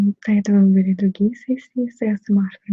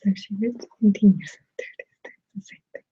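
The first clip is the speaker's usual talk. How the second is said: ASMR whisper. Real, not acted. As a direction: whisper close to the mic.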